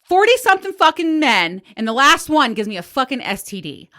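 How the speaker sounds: loud furious complaint; pissed rant. Retold or imitated. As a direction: vent it, outraged at the irony. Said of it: "I was so pissed".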